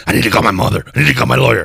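lightly imitating Harvey Fierstein's voice; a hint of that gravelly movie voice; Harvey Fierstein impression. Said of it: "I sound like Harvey Feinstein".

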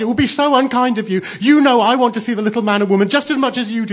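put-on high, loud childlike wailing; acted upset child, high and insistent; tearful crying out. A narrator's reading. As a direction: keep wailing in tearful reproach. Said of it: "she cried".

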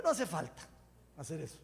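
ordinary talk

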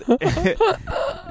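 high-pitched burst of laughter. Real, not acted. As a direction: squeal of laughter. Laughing at anniversary story.

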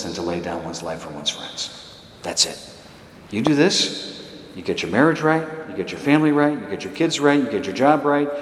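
ordinary delivery

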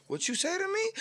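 high, hushed imitation of baffled Southerners; mock confused listener. Southerners puzzled by a New Yorker talking, mock confusion. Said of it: "you talk like a New Yorker".

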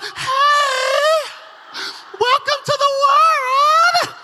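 loud, high, drawn-out cooing shout; hammed-up welcome, stretched way out; gushing parent. Silly parents greeting their screaming newborn, gushing. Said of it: "us silly parents look at a baby".

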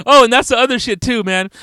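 ordinary talk

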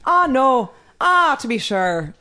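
hammed-up Irish accent, drawn out. Shown as a demonstration. Drunk party trick when asked for Irish, lilting Irish accent.

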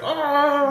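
high drawn-out mock "ahh"; imitated crowd "ahh". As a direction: imitate friends jeering in a high voice. Imitates the friends' group reaction.

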